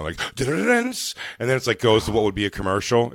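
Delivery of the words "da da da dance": high sung suspense stinger; mimicked dramatic sting. Cutting to commercial with a mock dramatic sting. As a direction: mimic a TV cliffhanger cue, high.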